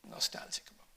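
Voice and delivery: breathy whisper at the end. Real, not acted. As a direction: let it fade into a whisper. Trailing confession, whispered.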